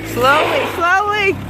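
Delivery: high, drawn-out "slowly" coaxing; drawn-out coaching. Shown as a demonstration. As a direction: coax "slowly, slowly" upward. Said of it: "coaching driver to ease clutch".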